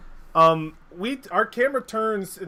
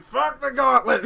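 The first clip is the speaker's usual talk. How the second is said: high, loud, drawn-out yell; slow rebellious holler; defiant shout; 8 semitones higher, 8 dB louder than usual. Acted. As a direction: renounce it with a rowdy acted roar. Soldier rips off badge, defies Gauntlet.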